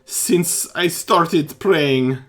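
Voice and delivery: loud, high pleading; frantic plea. Acted. Priest begging the party to pray for his boy, loud and high. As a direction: beg loudly, near breaking.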